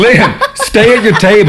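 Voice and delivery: high, slow telling-off; mock scolding. Companion to a wandering diner at the restaurant, mock scolding. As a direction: call him back like a naughty child.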